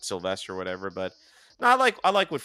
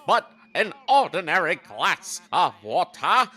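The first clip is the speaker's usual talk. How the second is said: high, slow, hammed-up cartoon voice; cartoon dog impression; 8 semitones higher, 5 dB louder, slower than usual. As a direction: ham up the high diving dog. Imitating cartoon dog's line.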